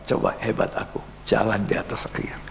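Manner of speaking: hushed whisper of a smug boast. Retold or imitated. Peter whispering his boast to friends.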